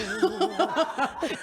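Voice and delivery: laughing. Real, not acted. Host laughs at forgotten lyrics.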